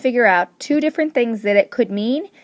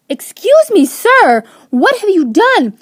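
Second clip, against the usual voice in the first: high, loud, aghast manager; outraged manager cries out; shocked outrage; 9 semitones higher, 8 dB louder, slower than usual. Acted. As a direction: yelp it out in disbelief. Shocked manager confronts panda.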